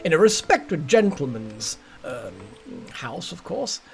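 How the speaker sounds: landed-gentry British accent, with hesitant pauses; proper British accent. Shown as a demonstration. Player showing an accent they can do, proper British gentry. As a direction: play a British lord of the manor or butler. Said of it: "a very proper sort of British accent".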